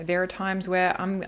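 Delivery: usual speaking voice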